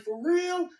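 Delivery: very high incredulous question; disbelieving question. Retold or imitated. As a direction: imitate a wife who can't believe it. Wife's reaction to shocking news.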